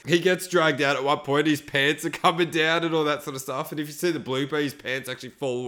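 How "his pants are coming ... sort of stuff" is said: normal talking voice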